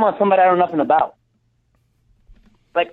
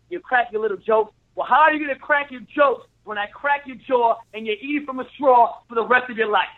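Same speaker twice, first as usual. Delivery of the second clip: tough-guy trash talk, pitched higher; wrestling promo; 3 semitones higher than usual. Acted. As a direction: cut a fierce heel promo. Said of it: "cut a promo for one minute".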